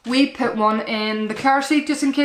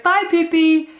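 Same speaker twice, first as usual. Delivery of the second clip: put-on high, louder little-girl bye; imitated toddler; 7 semitones higher, 5 dB louder than usual. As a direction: chirp it like a proud toddler. Mum repeating toddler's line.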